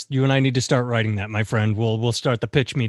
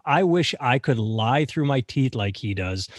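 ordinary talk, nothing marked

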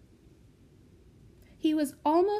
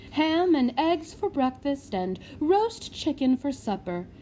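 fawning matron; 4 semitones higher, 4 dB louder than usual. Acted. Matron boasting to lord.

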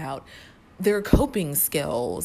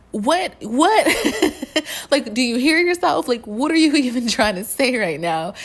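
baffled disbelief; 8 semitones higher, 7 dB louder than usual. Retold or imitated. Mocking jargon-speaking stranger.

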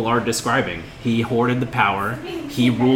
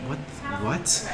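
puzzled crowd; 11 dB quieter than usual. Acted. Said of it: "the rest of the people from the crowd are like".

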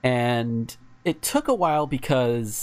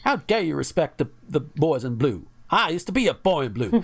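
put-on southern accent; 3 semitones higher than usual. Podcast host imitating the Joliet mayor in a southern accent. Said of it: "I don't know why I'm giving him that accent".

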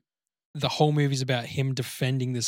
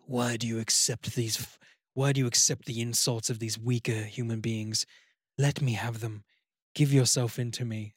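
a hint of a low, softer demon; lightly voiced demon coaxing him; tempting demon voice; 3 semitones lower, 3 dB quieter than usual. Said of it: "this voice in his head putting him down".

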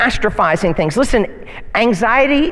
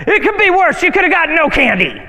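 mock scold; 9 semitones higher, 3 dB louder, faster than usual.

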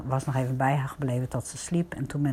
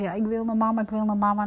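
put-on higher, pleading little-girl voice for the granddaughter; granddaughter wanting mama; 6 semitones higher, faster than usual. Granddaughter at bedtime begs for mama, higher pleading child voice. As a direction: voice the crying child crying for her mama. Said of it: "ja, zei ze".

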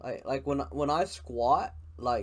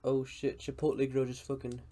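ordinary talk

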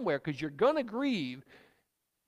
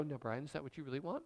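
fast, much softer questioning reply; gentle God voice; 5 semitones lower, 10 dB quieter, faster than usual. Acted. God answers Brian's angry prayer, soft and quick. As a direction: ask it quickly and softly, like God probing. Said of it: "wait for his answer".

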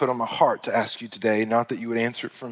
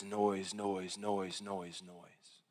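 fading repetition; 2 semitones lower, 12 dB quieter, much slower than usual. Real, not acted. Preacher on ears crowded with noise, repetition fading away softly. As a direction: repeat the word, each one quieter. Repeated word fades away under sermon point.

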